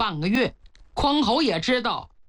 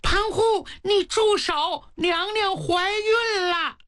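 high, slow, crying cry of alarm; frightened shout; 8 semitones higher, slower than usual. Acted. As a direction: maid shouting in fear to stop him. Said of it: "可把柳绿吓坏了". (惊慌的尖声喊叫)

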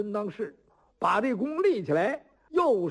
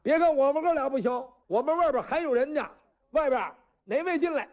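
higher challenge called to the crowd; shouting out; 6 semitones higher than usual. Acted. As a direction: call out a challenge to anyone outside. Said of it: "齐彪又喊上了".

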